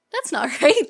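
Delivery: laughing through the words; giggling the line out. Retold or imitated. Receptionist reacts, laughing.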